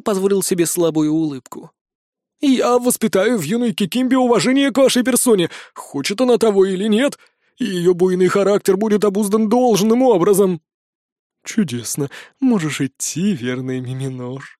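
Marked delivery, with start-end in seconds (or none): 2.4-10.6 higher, eager servile elf; fawning servant voice. A narrator's reading. 11.4-14.4 soft, slow and gentle; softly. A narrator's reading. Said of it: "мягко произнёс правитель Махис".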